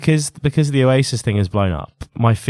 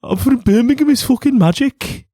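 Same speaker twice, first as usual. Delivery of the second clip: high-pitched mock Brummie accent; Birmingham accent attempt; 11 semitones higher than usual. Co-host trying a catchphrase, attempted Brummie accent. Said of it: "not Midlands".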